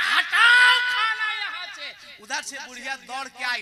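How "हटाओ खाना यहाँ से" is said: high, loud, slow furious shout; angry shout. Acted. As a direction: bark the order away. Father-in-law rejects the food. (गुस्से में ऊँची चीख)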